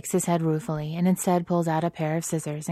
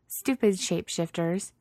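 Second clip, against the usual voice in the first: grumbling; 3 semitones higher than usual. A narrator's reading. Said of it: "she grumbles".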